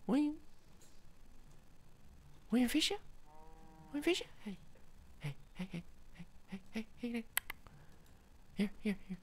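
high, soft little calls; coaxing a pet. Real, not acted. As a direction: tempt a shy cat in a small high voice. Coaxing cat with fish.

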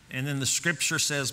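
ordinary delivery

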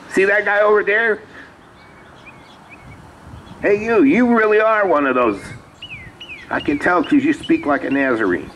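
very high put-on woman's voice, easing down; imitated busybody raising the alarm; accusing woman. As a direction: call him out in a high female impression. Woman points out Peter to bystanders.

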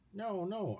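high, startled no-no from Arnold; protesting. A narrator's reading. Arnold protesting to the mother penguin, high and startled. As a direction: push back in a high voice. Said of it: "Arnold protested".

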